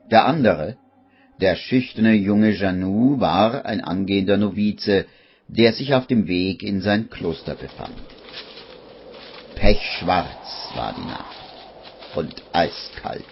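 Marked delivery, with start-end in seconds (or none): none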